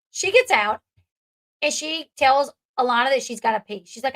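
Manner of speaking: ordinary delivery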